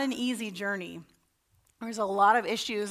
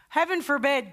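ordinary talk, nothing marked

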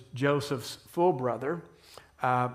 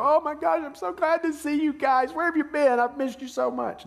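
much higher, louder gushing joy; tearful gushing reunion; 12 semitones higher, 5 dB louder than usual. Pretend. Joseph in a tearful reunion with his brothers, gushing. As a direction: gush like a long-lost brother. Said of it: "break down and go".

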